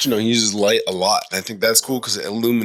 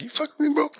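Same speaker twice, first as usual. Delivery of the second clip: mock challenge; 3 dB quieter, faster than usual. Retelling his challenge to a stranger.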